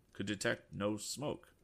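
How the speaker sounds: ordinary delivery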